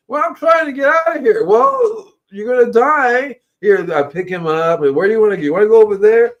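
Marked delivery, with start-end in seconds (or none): 0.1-1.4 much higher, small pleading voice; worm voice. Pretend. Worm answers in story. 1.4-3.4 teasing warning. Pretend. Playful worm dialogue. 4.9-6.3 coaxing the worm. Pretend. Talking gently to the worm.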